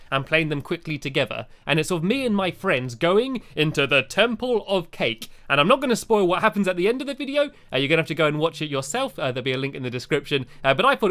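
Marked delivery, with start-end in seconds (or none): none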